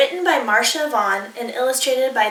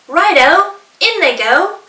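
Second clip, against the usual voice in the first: higher, louder cheerful; eager dingo; 6 semitones higher, 8 dB louder than usual. Acted. Dingo happily adding flies, high and loud. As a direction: toss them in gleefully.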